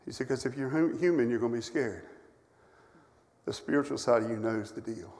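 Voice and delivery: a pastor recalling a friend's gentle rebuke; loving, kind. Retold or imitated. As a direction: ease it out kindly. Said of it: "in his loving, kind way".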